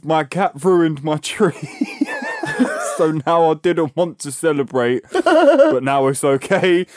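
reading slowly through laughter; laughing. Real, not acted. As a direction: read it while cracking up. Reads message while laughing.

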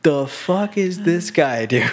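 mock incredulous Marine; imitated bafflement. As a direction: voice a baffled onlooker's thought. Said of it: "is looking at him like".

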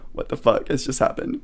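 whispered in disbelief; stunned whisper. Retold or imitated. Artist retelling her exhibition rush, whispering in disbelief. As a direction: breathe it out, overwhelmed. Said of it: "And I'm like".

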